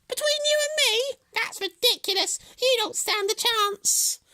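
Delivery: very high, loud hare voice; incredulous hare. A narrator's reading. Hare ridicules Tortoise's challenge, high, loud and incredulous. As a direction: scoff in a high squeal. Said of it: "he gasped".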